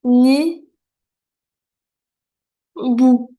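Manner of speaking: "ha", "ni", "bu" with long pauses between; teacher's slow decoding, one syllable at a time; syllable by syllable reading. Shown as a demonstration. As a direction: sound out each syllable separately. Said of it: "je vais lire".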